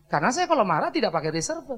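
ordinary talk

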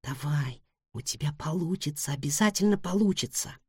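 soft hushed encouragement; whispered pep talk. Acted. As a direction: whisper it like a secret hope from afar. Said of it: "как она шептала".

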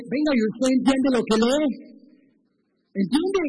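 preguntas de Felipe, más altas y fuertes; insiste, sube el tono en la pregunta; Felipe interpelando. Acted. (Felipe pregunta alto y con insistencia)

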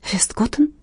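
a soft gasped question; surprised gasp. Acted. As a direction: gasp the name in quiet surprise. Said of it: "ахнула Инга".

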